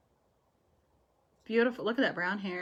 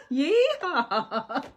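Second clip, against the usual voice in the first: loud laughing yeehaw cheer; a delighted cowboy holler; whooping yeehaw; 7 semitones higher, 8 dB louder than usual. Real, not acted. Joyful cheer.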